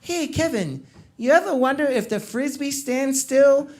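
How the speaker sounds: dreamy stoned musing, pitch rising at the end; high friend pondering a Frisbee, dreamy. Retold or imitated. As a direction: wonder it aloud like a stoned genius. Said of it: "he's like".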